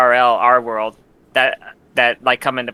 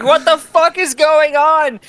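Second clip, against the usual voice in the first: high, slow, loud disbelief; much higher, drawn-out stunned cry; shocked Roxas; 13 semitones higher, 5 dB louder, slower than usual. Retold or imitated. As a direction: stretch it out in total shock. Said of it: "Rox is like".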